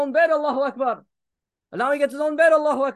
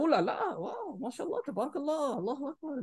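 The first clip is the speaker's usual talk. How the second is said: mock smitten kid; 10 dB quieter than usual. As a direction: tease the kid drooling over a picture. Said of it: "He's gonna be like".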